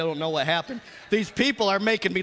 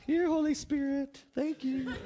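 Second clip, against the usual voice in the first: coaxing a pet; 8 semitones higher, 3 dB quieter than usual. Retold or imitated. Coaxing the Spirit back into a box.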